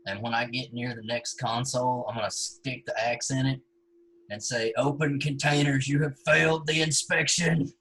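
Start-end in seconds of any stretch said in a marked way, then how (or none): 4.8-7.7 hammed-up official voice, louder; mock announcer voice. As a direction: declare it like a pompous inspector. Character voice announcing to console.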